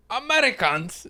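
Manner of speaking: high-pitched mock British grumble; attempted British accent. Co-host guessing the cleaner's reaction, put-on British accent. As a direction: try out a disdainful British voice. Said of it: "Is that the accent?".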